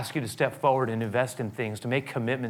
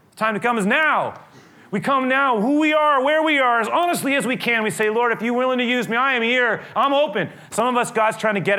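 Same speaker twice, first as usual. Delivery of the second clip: much higher and louder impassioned preaching; preaching at full force; 11 semitones higher, 6 dB louder than usual. Acted. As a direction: rise to a fervent altar-call peak. Preaching climax, voice rising.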